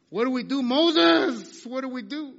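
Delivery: loud, high, panicky plea to Moses; panicked cry. Acted. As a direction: cry out in alarm, trapped between army and sea. Israelites panic before sea.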